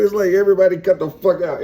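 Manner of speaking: ordinary talk, nothing marked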